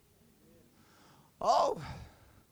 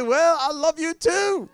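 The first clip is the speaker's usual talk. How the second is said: high, loud, slow mock affection; gushing reply; 8 semitones higher, 5 dB louder, slower than usual. Preacher answering the love-struck ticket lady, playful gushing. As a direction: gush it back, stretched out and playful. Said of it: "I say".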